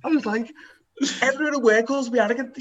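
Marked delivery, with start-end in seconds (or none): 1.2-2.6 high-pitched, put-upon retelling; retold outraged comeback; mock indignation. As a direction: exclaim it high, as if scandalised. Retelling comeback to being called arrogant.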